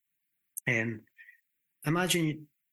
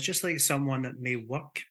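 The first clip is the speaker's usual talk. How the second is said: usual speaking voice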